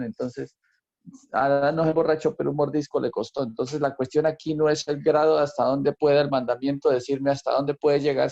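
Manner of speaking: ordinary talk, nothing marked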